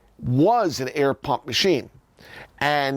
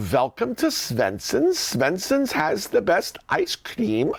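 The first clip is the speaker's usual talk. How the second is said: slow, sing-song Swedish greeting; Swedish accent; 4 semitones higher, slower than usual. Acted. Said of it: "with the proper Swedish accent".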